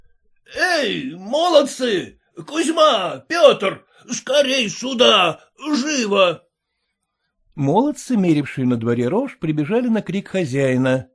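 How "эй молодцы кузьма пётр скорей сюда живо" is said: raised call across the yard. A narrator's reading. Master calls farmhands to come quickly, calling out. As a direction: summon the lads urgently.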